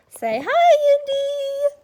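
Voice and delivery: sing-song coaxing of a puppy; pet talk. Real, not acted. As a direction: coax the dog in a squeaky pet voice. Coaxing the dog to greet.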